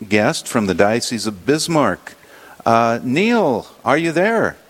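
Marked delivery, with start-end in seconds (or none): none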